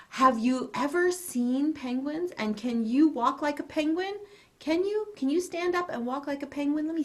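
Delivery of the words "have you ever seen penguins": ordinary delivery, nothing marked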